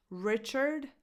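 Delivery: "Richard" with a strong RICH and weak second syllable; "Richard" stressed on its first syllable, lax i as in "it". Shown as a demonstration. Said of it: "the stress goes on this first syllable".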